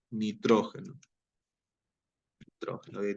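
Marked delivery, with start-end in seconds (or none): 2.4-3.1 whispered to herself. Real, not acted. Teacher murmuring word while writing.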